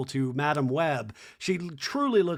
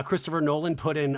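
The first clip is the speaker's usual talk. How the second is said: usual speaking voice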